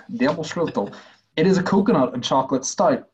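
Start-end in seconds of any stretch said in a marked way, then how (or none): 0.1-0.9 a light put-on Dutch accent on Demerschlüssel; mock Dutch accent. Shown as a demonstration. Asked to try a Dutch accent, he says Demerschlüssel in mock Dutch. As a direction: say Demerschlüssel like a Dutch speaker, half laughing. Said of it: "Say it in a, in a Dutch accent".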